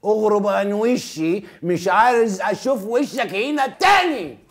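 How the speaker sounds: Egyptian film threat. Shown as a demonstration. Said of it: "بالمصري بقولوا".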